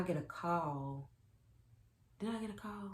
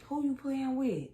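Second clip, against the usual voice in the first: retold accusing question; 8 semitones higher, 4 dB louder than usual. Confronting cheating boyfriend.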